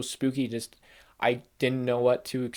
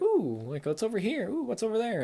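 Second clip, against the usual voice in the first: higher, wide-eyed wondering ooh; mock curious ooh; 9 semitones higher than usual. Vlogger recalling exploring the map, higher curious ooh. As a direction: play a kid poking round every corner.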